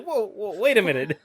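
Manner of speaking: high laughing whoa, wait a minute; mock alarm through laughter; surprised. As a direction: stop him mid-story in disbelief. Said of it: "That's like".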